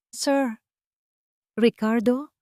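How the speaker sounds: injured maid weakly calling her employer. Acted. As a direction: call out weakly, barely able to get up. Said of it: "Ang nanghihina niyang tawag".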